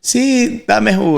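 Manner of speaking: high mock wife voice; husband mimics wife's high delighted yes; imitating his wife. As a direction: squeak it up like his wife accepting juice. Husband quotes his wife answering.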